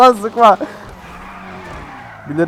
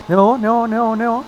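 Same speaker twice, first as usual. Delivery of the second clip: ordinary talk, nothing marked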